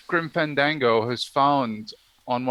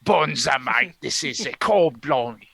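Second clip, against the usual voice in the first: put-on Australian accent; 3 semitones lower than usual. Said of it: "my Australian accent is same as".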